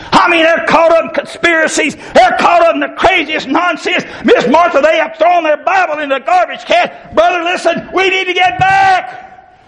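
fiery street preacher shouting at the congregation; preaching at full force. Acted. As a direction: build the sermon into a shouting call.

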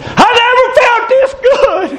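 a healed man shouting that he feels good; ecstatic joyful shout. Retold or imitated. Overjoyed man crying out to the preacher in the park, high ecstatic shout.

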